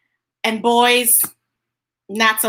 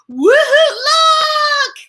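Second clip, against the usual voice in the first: high, loud and slow with a laugh; excited; 7 dB louder, much slower than usual. Shown as a demonstration. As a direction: shout it out with joy, like a kid who spots something. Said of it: "that means to say it, like, excited".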